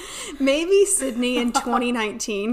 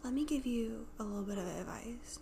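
imitating a whisper, much softer; whispered advice; 13 dB quieter than usual. Imitating the sweet advisor offering advice, whispered. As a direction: breathe it like a secret kindness.

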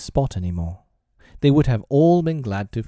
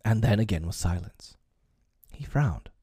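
ordinary delivery, nothing marked

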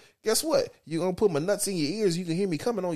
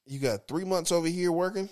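ordinary delivery

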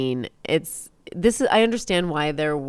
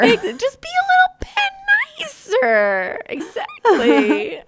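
much higher and louder, laughing; squeaky mock voice; 6 dB louder, slower than usual. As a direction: squeal it out in a silly falsetto.

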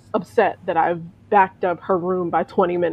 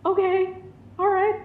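much higher, nervous squeaky okay; voice shooting up high; 12 semitones higher than usual. Retold or imitated. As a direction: squeak it out in a jumpy, rattled pitch. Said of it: "my voice goes up, like, several octaves".

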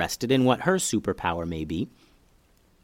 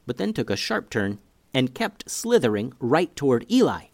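usual speaking voice

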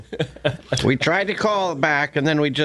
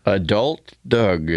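deep mock adult voice; 8 semitones lower, much slower than usual. Repeating name in mock deep voice.

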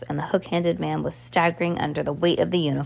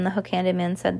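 ordinary delivery, nothing marked

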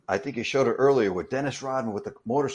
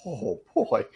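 put-on a high uneasy oh boy; bracing for danger; nervous dread; 7 semitones higher than usual. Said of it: "I'm like".

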